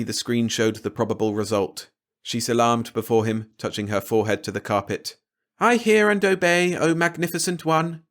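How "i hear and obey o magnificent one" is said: higher, mock-obedient and sarcastic; bow and mock him. A daughter mocking her father's command, sarcastically. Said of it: "Sarcasm was more than he could bear".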